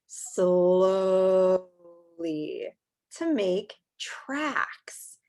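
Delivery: very slow, drawn-out reading; dragged slowly. A narrator's reading. Reading to children, slow dragging words. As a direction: drag the words like feet in snow.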